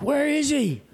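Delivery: high, urgent shout of a question; retold alarmed call. Friend at the surface asking after missing diver, high urgent call. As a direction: call it out high across the water.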